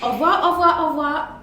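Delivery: a light imitation of quick French au revoir; tossed-off native au revoir, lightly mimicked; French speaker. Imitating how French people say au revoir, lightly. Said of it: "so you hear".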